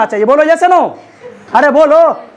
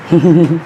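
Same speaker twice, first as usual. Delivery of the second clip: low chuckle; 9 semitones lower than usual. Real, not acted. Teacher amused by a student in class, low chuckle.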